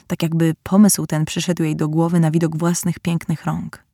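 ordinary delivery, nothing marked

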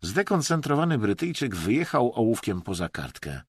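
plain reading voice

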